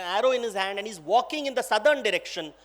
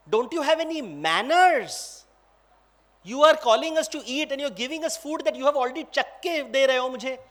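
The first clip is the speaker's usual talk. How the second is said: indignant scolding, somewhat raised voice; very upset; 3 semitones higher, faster than usual. Acted. Lakshman scolding the lady for her manners, upset. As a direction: tell off someone ill-mannered. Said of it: "He's very upset and he's saying".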